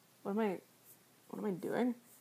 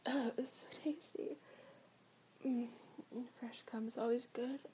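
whispering; 6 dB quieter than usual. Acted. Savouring after the act.

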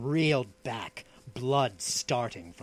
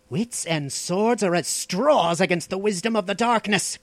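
raised, indignant growl of a retort; dark sorcerer's angry rebuke, pitched up; 9 semitones higher, 8 dB louder than usual. A narrator's reading. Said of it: "growled the Stygian".

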